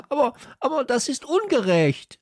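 hoch, empörter Protest. A narrator's reading. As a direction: kindlich empört rufen. Kalle protestiert. (empörter Aufschrei)

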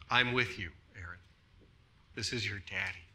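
low, soft reassurance; comforting fatherly tone. Acted. Father to his sobbing daughter after a nightmare, quiet and comforting. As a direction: soothe a frightened child quietly. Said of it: "that is the tone of voice".

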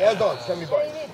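ordinary talk, nothing marked

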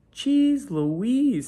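high, loud "Jeez Louise"; amazed exclamation. Acted. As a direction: gasp it out, amazed. Mouse amazed turned driver.

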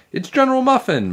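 high-pitched alarmed cry; yell. A narrator's reading. Michael spotting the cockroach, alarmed yell. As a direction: call out the sighting in alarm. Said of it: "Michael yelled".

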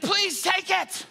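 high, slow, drawn-out cry; exasperated plea. Acted. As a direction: beg at the end of your rope, hammed-up. Fed-up Peter begs Jesus.

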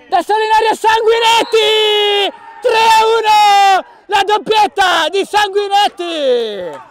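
high slow triumphant goal yell; goal shout. Real, not acted. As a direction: roar out the score. Goal called live.

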